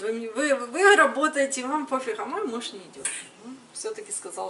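ordinary talk, nothing marked

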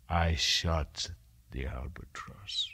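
low whisper of guilt; whispered confession. A narrator's reading. Mariner's grim confession.

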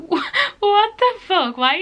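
high, loud incredulous outburst; laugh, then burst out baffled; exasperated high-pitched disbelief with a laugh. Real, not acted. Mocking exaggerated texts, laughing disbelief.

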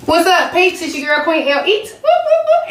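high hyped-up greeting; bouncy signature opener; intro catchphrase. Acted. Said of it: "repeats channel intro catchphrase".

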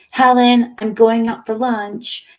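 high, loud call, slowly drawn out; yelling. A narrator's reading. Mona calling to Helen as she leaves for lunch, yelling. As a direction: yell it out so it carries.

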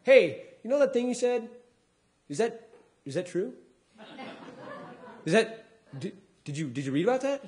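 a hint of a hesitant fan checking facts; lightly voiced audience member. Audience member asking the comedian about a claim, lightly imitated and hesitant.

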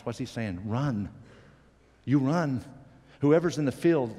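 ordinary talk, nothing marked

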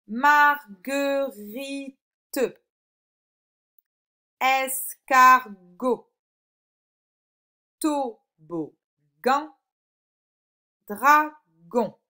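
loud, slow, separated syllables. Shown as a demonstration. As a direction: sound out each word for a pupil. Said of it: "dans quelle syllabe". (découpage lent en syllabes)

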